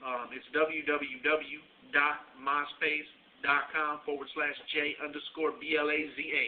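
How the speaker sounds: ordinary delivery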